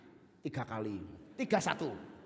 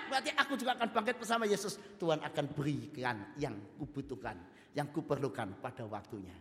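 ordinary delivery

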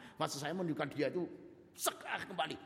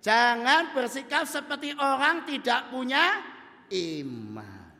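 loud, high preaching; preaching at full force; 9 semitones higher, 10 dB louder than usual. Real, not acted. Preacher urges the congregation, loud and high. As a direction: drive the point home.

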